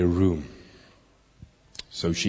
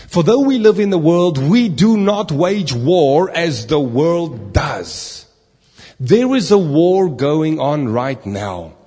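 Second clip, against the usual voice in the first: full-force preaching; 3 semitones higher, 4 dB louder than usual. Acted. Pastor warning the congregation about spiritual war, full-force preaching. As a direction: preach it out to the whole church.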